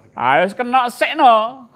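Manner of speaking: high, loud, slowed cry; mock dismay. Preacher acting a boxer already hit, loud cry. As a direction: cry out as the punch lands.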